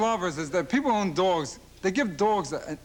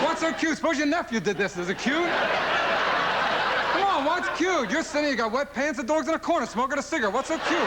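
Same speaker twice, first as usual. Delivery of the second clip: incredulous; 8 semitones higher than usual. Real, not acted. Incredulous rant at dog owners.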